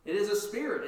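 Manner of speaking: a mild mock fearful cry. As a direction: disciples crying out in fear. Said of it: "they cried out for fear".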